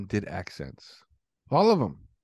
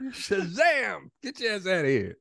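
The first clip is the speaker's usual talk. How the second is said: high comic exclamation; hammed-up magic word, then a send-off; mock spell cry; 9 semitones higher, slower than usual. Acts out magic word, banishing.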